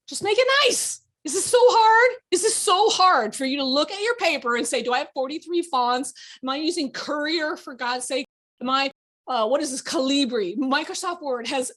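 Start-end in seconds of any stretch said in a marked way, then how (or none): 0.1-1.0 much higher and louder, whining; high mock plea "Just make it nice"; mock high whiny voice. Shown as a demonstration. 1.2-10.6 high, loud, exasperated; mock despair at the fonts; exasperated scolding. Exasperated teacher scolding students.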